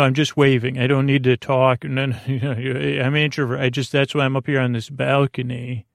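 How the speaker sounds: ordinary delivery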